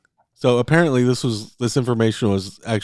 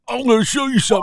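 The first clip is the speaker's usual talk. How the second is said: high, hammed-up Scottish accent; 11 semitones higher, much faster than usual. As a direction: attempt a Scottish Durin, pitched way up. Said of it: "how do you do the Scottish accent?".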